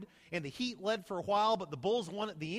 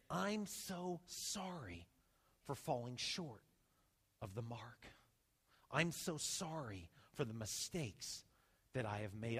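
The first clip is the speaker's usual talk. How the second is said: slow, much softer, sorrowful apology; penitent confession; 8 semitones lower, 10 dB quieter than usual. Pretend. Pastor voices a sinner's sorry prayer, slow and hushed. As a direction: confess softly like a broken sinner. Voicing a repentant heart.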